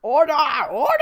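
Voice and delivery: loud, high courtroom shout; calling for order. Acted. Judge calls court to order.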